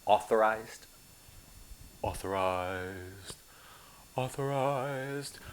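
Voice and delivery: "Authorized." drawn out slowly and softly, over and over; slow repeated word. Shown as a demonstration. Instructor drilling the word authorized, slow and soft. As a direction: let "Authorized" linger, slow and quiet. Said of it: "notice the word authorized".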